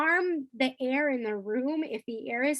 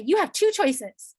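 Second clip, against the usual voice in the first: firm, louder and slower laying down the choice; stern ultimatum; 5 semitones higher, 7 dB louder, slower than usual. Shown as a demonstration. Teacher giving a child a cold ultimatum, stern. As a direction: clamp down on the kid, no warmth. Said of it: "I could say it like that".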